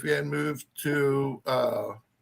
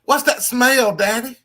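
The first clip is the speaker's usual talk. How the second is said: put-on country-accented little girl; 10 semitones higher, 9 dB louder than usual. Dad imitating his four-year-old daughter, country accent. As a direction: mimic a small girl's Southern twang. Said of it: "Where the hell is that accent coming from".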